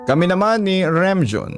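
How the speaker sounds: ordinary delivery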